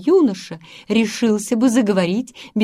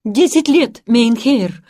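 higher, louder burst of hope; hopeful exclaim; 4 semitones higher, 8 dB louder than usual. A narrator's reading. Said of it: "воскликнул Ханс".

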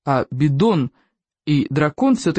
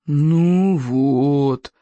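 groaning; 3 semitones lower than usual. Acted. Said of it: "застонал Сморкало".

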